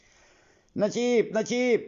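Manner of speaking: high, slow drawn-out call. Acted. A lost boy calls for Nachip in the forest, long high call. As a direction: call his name into the dark woods. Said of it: "แกร้องเรียก".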